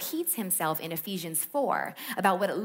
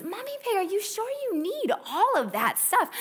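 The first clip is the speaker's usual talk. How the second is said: high, playful cartoon dad; Daddy Pig voice; 11 semitones higher than usual. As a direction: voice Daddy Pig for the kids, higher up. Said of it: "Daddy Pig says to Mommy Pig".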